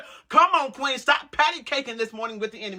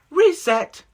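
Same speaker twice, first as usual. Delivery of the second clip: "Reset" shouted higher and louder; emphatic reset; 7 semitones higher, 6 dB louder than usual. Real, not acted. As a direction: punch out "Reset" as a command. Quiet then shouted reset.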